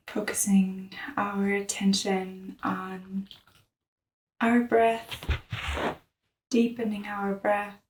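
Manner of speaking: soft, hushed meditation voice; softly led breathing practice; guided meditation. Real, not acted. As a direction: guide them gently into the breath, with long pauses.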